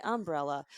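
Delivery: "umbrella" as UM-brella; front-stressed Southern "umbrella". Shown as a demonstration. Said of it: "why can't I say".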